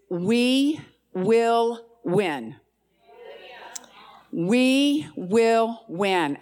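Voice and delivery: each word of "We will win" drawn out slowly; solemn slow decree; slow declaration. Shown as a demonstration. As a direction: proclaim it word by word. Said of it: "the word he's given me is".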